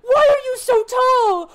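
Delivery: high, loud squealing questions; a tiny creature shouting in amazement; excited kobold. Acted. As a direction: kobold mobbing the tall stranger, high and shouting. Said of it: "the kobold's like".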